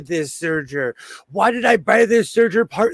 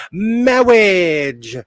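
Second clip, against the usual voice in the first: louder, drawn-out mock clergyman saying "Marriage" as "mawwiage"; Princess Bride clergyman; 2 semitones higher, 5 dB louder than usual. Host quotes the Princess Bride clergyman to chat, mock wedding sermon. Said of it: "I love A Princess Bride".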